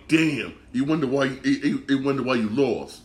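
normal talking voice

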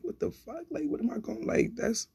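high, hushed retold disbelief; softly squeaked mock bewilderment; baffled protest. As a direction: stammer it high, lost for words. Retells own baffled reaction.

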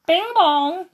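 high, ringing bell-like cry; alarm cry. Acted. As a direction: ring it out high to wake everyone. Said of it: "cried Five".

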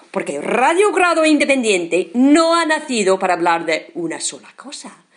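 ordinary talk

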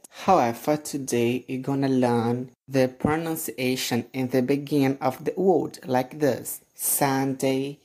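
ordinary talk, nothing marked

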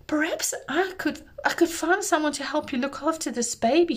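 high, fast eager offer; nervous rush. Acted. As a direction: blurt it out hopefully. Miriam nervously offers help.

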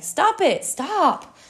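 high, loud acted plea; crying out. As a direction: cry out desperately as the teacup. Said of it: "I cried out".

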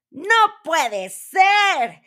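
high, loud, drawn-out cry; shouting. A narrator's reading. Dwarf hearing his secret name guessed, high outraged shout. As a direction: shout it slowly in disbelief, high-pitched. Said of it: "gritó él".

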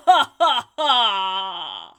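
slow booming mock evil cackle; evil villain laugh. Evil laugh after spooky intro.

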